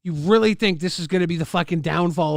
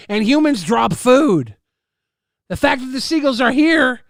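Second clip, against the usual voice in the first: loud, higher, heated rant; angry rant; 8 semitones higher, 5 dB louder than usual. Real, not acted. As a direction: tear into the complainer loudly. Ranting against the seagull complaint.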